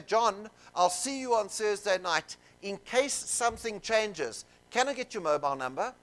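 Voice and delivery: slow, careful booking script; slow demo line. Shown as a demonstration. Salesperson to client John, slow deliberate request. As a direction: model the ask calmly. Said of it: "I'll say".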